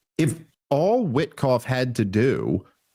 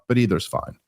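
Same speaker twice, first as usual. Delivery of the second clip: ordinary delivery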